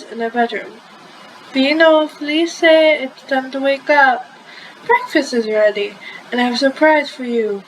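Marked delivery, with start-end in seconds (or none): none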